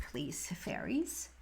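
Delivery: soft breathless whisper to the fairies; whispered plea. Real, not acted. Reader whispering a request to the fairies, hushed. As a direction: ask the fairies quietly, almost no voice.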